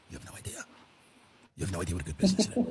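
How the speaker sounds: whispered secret, then low and fast; conspiratorial whisper. Acted. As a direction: lean in and share the secret. Conspiratorial rich person aside.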